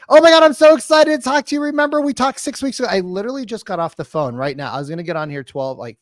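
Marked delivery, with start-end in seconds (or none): 0.1-2.9 high, fast, overexcited sales gush; happy puppy. Shown as a demonstration. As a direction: gush loudly at a cold prospect. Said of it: "What does the happy puppy do".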